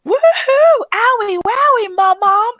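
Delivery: put-on very high, loud little-boy catcall; drawn-out high silly woohoo; toddler whooping. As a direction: imitate a tot gleefully hooting at grandma. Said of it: "all of a sudden I hear him go".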